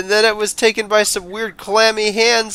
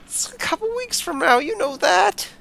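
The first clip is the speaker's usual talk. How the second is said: whimpering plea; 9 semitones higher than usual. Acted. Herbert whimpers, cry heard.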